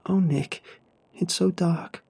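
high, anxious lament; pitched well up, plaintive; rising pitch. A narrator's reading. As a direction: let the voice climb high. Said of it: "the pitch of her voice rising".

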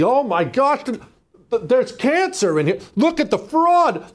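high, loud, stammering horror; hammed-up shock at a horrible discovery; mock mortified. Peeking through a cracked window at fraud, hammed-up mortified. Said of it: "we're mortified by what we see".

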